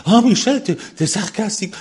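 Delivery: high, loud whiny mock complaint; mocking complainer. Pastor mocking the flock's complaint about his sarcasm, high whiny voice. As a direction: mimic a parishioner's whine. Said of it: "venir vous plaindre".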